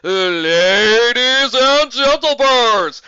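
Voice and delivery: high, loud, slow announcer bellow; booming shout. Acted. Old Griffin announcing the contest, slow booming shout. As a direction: boom it across the whole town. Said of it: "shouted in a booming voice".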